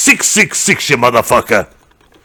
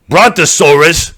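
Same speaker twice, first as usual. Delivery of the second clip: ordinary talk, nothing marked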